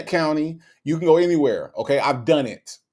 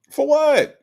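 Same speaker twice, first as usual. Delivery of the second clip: high-pitched, louder disbelieving question; incredulous; 13 semitones higher, 5 dB louder than usual. Real, not acted. Host doubting any prize for hurting Nesto, incredulous. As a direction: throw it out high, in sheer disbelief.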